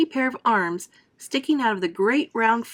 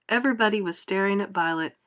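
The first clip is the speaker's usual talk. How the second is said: ordinary delivery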